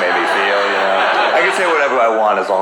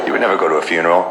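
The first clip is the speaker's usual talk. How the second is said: usual speaking voice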